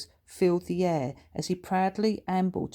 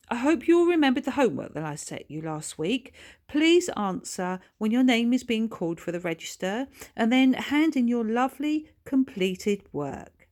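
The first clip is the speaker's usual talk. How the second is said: high, bright-toned schoolteacher addressing the class; cheery teacher; 6 semitones higher, 4 dB louder than usual. A narrator's reading. Teacher reminding pupils about homework, high and cheery. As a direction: sing it out to the whole class. Missus Rowlands voiced.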